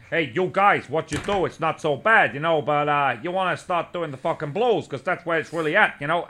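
put-on Italian New York accent. Telling the band to play blues, in an Italian New York accent. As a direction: imitate a pushy Italian New York guy. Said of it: "a kind of an Italian New York accent".

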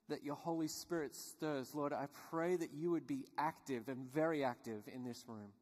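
prayer. Real, not acted. Pastor leading the congregation to seek God, hushed prayer. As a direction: pray quietly and earnestly, leaving long pauses.

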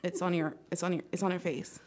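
put-on understated, hesitant kid voice; deadpan child to mom. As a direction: point it out quietly to a parent. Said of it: "Everybody looking up like".

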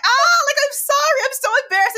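high wailing sob, hammed up; mock sobbing cry. Fleeing girl cries to the boy, high mock wail. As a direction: wail it out like a girl bawling in embarrassment. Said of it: "literally running and sobbing".

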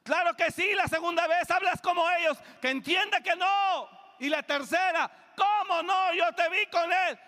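high, loud insistent accusation; shouting accusation. Retold or imitated. Crowd pressing Peter a third time, shouting high. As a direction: hammer him with the accusation.

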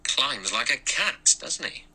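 slow, high-pitched musing; odd insinuation. A narrator's reading. Blore watches Lombard climb, odd note in his voice. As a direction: draw it out with something odd in it. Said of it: "something odd in his voice".

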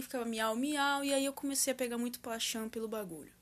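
usual speaking voice

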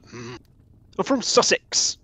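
lightly put-on Sussex country accent, drawn out. Player voicing a hobo saying where he's from, Sussex accent. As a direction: answer in a mock Sussex accent. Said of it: "You need to have an accent".

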